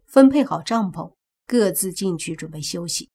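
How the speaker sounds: ordinary delivery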